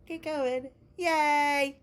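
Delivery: mock cheer, high and loud on yay; sarcastic yay. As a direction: fake celebration, dripping sarcasm. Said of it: "No, not yay".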